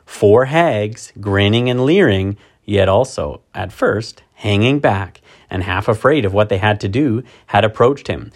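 ordinary delivery

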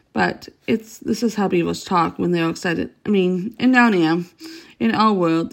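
ordinary delivery

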